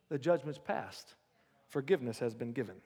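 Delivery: low, hushed altar-call preaching; quiet preaching. Acted. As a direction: draw them in softly and low. Softens to intimate altar call.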